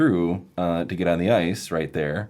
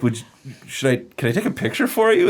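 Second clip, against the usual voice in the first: eager offer; 11 semitones higher than usual. Retold or imitated. Retold eager offer to engaged couple.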